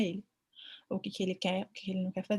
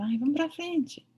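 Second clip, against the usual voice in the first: higher, slower "Ai, vamos pra frente" urging oneself on; coaxing own brain; 7 semitones higher, slower than usual. Retold or imitated. Vet urging her own brain to move on, slow and higher. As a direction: talk yourself into moving on, slowly and higher. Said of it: "Eu faço assim com o meu cérebro".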